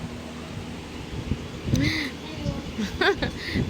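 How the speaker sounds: high amused laugh; laughter. Real, not acted. Host about to show her guests, laughing. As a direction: laugh before speaking.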